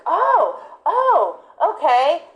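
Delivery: high, dawning "oh, oh" in the dog's voice; a dog catching on; dog realizing. Retold or imitated. Dog understanding the task, high eager realization. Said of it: "And the dog's like".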